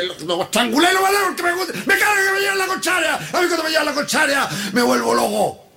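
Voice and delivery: high-pitched mock-furious shouting; crazed rant. Ex-prisoner on being contradicted, crazed acted rant. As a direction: play a madman flying off the handle. Acted murderer loses his temper.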